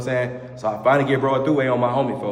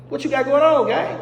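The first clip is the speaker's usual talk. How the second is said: high confronting call-out; 11 semitones higher than usual. Retold or imitated. Calling out a suspicious cellmate, high challenging tone. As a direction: pitch way up, calling him out hard.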